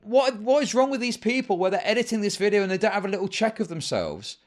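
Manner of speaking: loud, high, disbelieving outburst; incredulous outburst. Real, not acted. Streamer yelling at a creator's apology statement, incredulous and loud. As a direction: yelp it out in utter disbelief.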